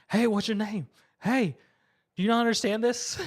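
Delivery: lightly acted demand for attention; delinquent calling out. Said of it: "one of the other delinquents trying to".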